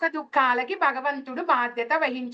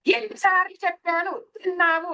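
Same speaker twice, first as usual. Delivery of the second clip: acted parental telling-off, pitched up; angry scolding mother; 4 semitones higher than usual. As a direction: scold the child like an exasperated mother. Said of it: "చేసింది అన్న కోపం ఒకటి". (కోపంగా తిడుతున్న అమ్మలా, గొంతు పెంచి)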